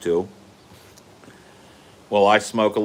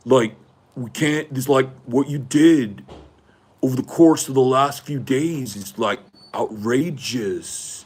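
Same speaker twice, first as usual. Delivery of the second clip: put-on higher, drawn-out entitled rich boy plea; smug rich boy voice, pitched up; imitating George Hughley; 4 semitones higher than usual. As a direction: play the privileged college boy coaxing her calmly. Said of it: "I'm doing a very good impression of George Hughley".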